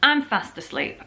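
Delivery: usual speaking voice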